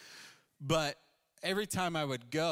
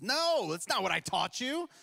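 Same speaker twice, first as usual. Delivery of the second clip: raised, higher mock scolding; a coach snapping back to correct him; instructor's stern correction; 8 semitones higher, 5 dB louder than usual. Golf instructor correcting his student, higher and louder.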